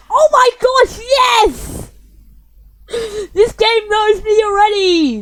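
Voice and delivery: high, delighted shouting; excited shout. Real, not acted. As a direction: squeal with real joy. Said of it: "So cute! That's what I wanna say".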